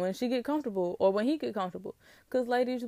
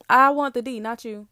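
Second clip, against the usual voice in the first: blunt brush-off; 3 semitones higher, 8 dB louder than usual. Pretend. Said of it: "Let him know".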